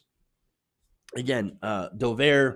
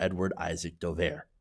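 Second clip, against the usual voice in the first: Edward Isaac Dover, dropped low and soft, stress on Edward; disdainful name; 7 semitones lower, 7 dB quieter than usual. Real, not acted. Telling viewers about a disliked journalist, low and soft disdain. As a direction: let the name fall low and quiet with disdain. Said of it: "I have no love for".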